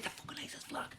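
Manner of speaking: hushed, voiceless whisper; whispered confession. Retold or imitated. Son admitting to his mum what the flag is, whispering. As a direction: whisper it as if it mustn't be overheard.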